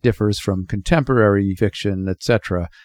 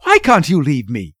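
loud, higher-pitched angry outburst; indignant daughter, voice raised; furious; 6 semitones higher, 6 dB louder than usual. A narrator's reading. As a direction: snap furiously at your mother. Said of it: "she said furiously".

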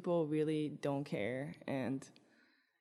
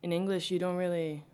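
ordinary talk, nothing marked

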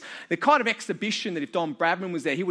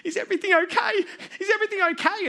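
put-on much higher, hurt little boy's voice; crying child; 12 semitones higher, faster than usual. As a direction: mimic the injured ten-year-old, high and tearful. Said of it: "He's sort of like".

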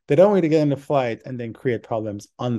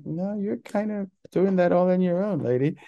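sarcastic; 8 semitones higher than usual. Retold or imitated. Speaker's retorted inner reply.

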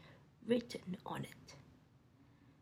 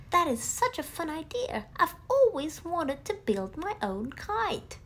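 higher, louder eager little voice; Roo's voice; 6 semitones higher, 7 dB louder than usual. Acted. Roo eagerly agreeing to build kites with friends. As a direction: chirp it like a small excited kangaroo. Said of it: "said Roo".

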